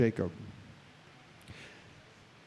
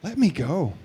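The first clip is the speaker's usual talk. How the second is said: calm surrender. Shown as a demonstration. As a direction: say it gently, as a release. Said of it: "we can hear it like this".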